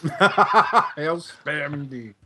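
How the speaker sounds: slow words through a laugh. Real, not acted. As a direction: laugh it out slowly. Laughing at a joke.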